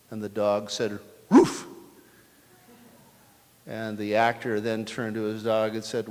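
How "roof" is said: a loud, high bark that sounds like "roof"; dog barking a word. Acted. Dog answers the bartender's question.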